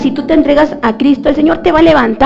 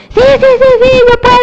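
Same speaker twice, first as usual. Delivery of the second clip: much higher squeaky yes, yes; mock eager agreement; 10 semitones higher, much faster than usual. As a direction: parody an overexcited believer. Emotional believers agree eagerly.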